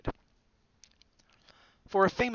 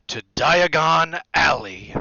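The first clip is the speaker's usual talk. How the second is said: loud, slow, high-arched announcement; grand welcome; 4 semitones higher, 10 dB louder, slower than usual. A narrator's reading. Hagrid unveiling the street to Harry, grand slow announcement. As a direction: present it with showman's relish.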